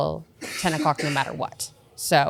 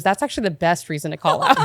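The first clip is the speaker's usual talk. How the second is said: laughing approval; 4 semitones higher, 5 dB louder than usual. Retold or imitated. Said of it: "She goes".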